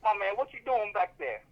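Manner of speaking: mock stage call to the back of the room; heckling comedian. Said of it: "He's like".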